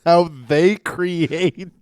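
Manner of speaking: laughing. Real, not acted. Repeating friend's line, laughing.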